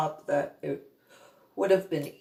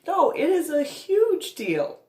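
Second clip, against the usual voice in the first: much higher, crying out in disbelief; hurt and furious at once; tearful outburst; 10 semitones higher than usual. Retold or imitated. Outburst at mother.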